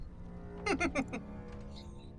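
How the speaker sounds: quiet, high-pitched chuckle; little laugh under the breath; soft chuckle. Real, not acted. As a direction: chuckle softly at impending doom. Relishes impending doom.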